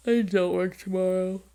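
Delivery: said out loud with a grin. Real, not acted.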